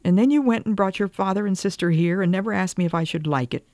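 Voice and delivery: high-pitched, mildly tearful outpouring; tearful pleading. A narrator's reading. Wife telling husband of her hungry heart, tearfully pleading. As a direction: plead with rising pitch, as if near tears. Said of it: "Will you stop crying".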